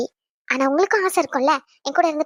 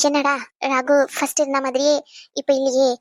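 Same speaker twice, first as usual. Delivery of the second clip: normal talking voice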